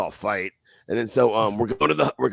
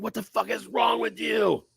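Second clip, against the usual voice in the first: higher, slow, shouted at the friend; exasperated yell; 9 semitones higher than usual. Retold or imitated. Scolding his dumbass friend for chasing them, a high slow yell. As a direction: yell at him in disbelief. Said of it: "And I'm like".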